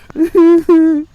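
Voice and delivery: sudden high laughing shriek; high shriek of laughter. Real, not acted. Vlogger bursting out laughing mid-rant.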